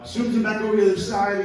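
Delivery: usual speaking voice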